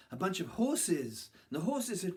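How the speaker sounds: ordinary delivery, nothing marked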